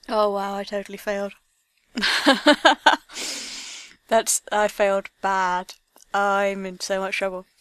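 loud, laughing disbelief; excited laughing outburst. Real, not acted. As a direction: blurt it out, cracking up. Fails challenge, bursts out laughing.

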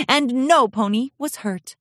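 usual speaking voice

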